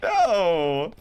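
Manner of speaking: high, helpless wail of regret; laughing anguish. Real, not acted. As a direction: wail through laughter at your own mistake. Still laughing over the blunder.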